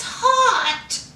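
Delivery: drawn-out mock swoon; imitating Lacey. Telling viewers how she described her new man, mock swooning. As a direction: stretch out HOT in mimicry of an infatuated woman. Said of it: "Ain't that how she said it".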